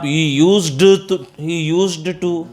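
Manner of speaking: "He used to" dragged very slowly with the d said, tongue tripping; slow, halting "he used to", d sound kept; stumbling used to. Shown as a demonstration. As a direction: stumble over "used to", forcing the d. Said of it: "वैसे ही stumbling हो रही है टंग".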